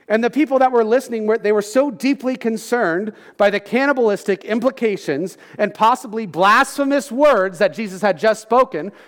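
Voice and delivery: normal talking voice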